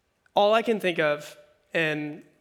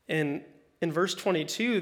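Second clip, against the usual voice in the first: normal talking voice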